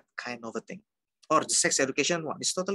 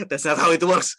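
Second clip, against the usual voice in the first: louder, higher, through laughter; real laughing; 4 semitones higher, 8 dB louder than usual. Real, not acted. Teacher joking about manga education, laughing. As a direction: laugh while objecting.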